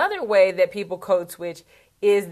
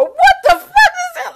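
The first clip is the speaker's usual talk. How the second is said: high, loud, laughing mock yell of disgust; shocked shriek; 11 dB louder than usual. Imitating the anchor losing it when a bug flies in, loud shocked shriek. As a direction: shriek it like a bug just flew in your mouth. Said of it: "he just loses it".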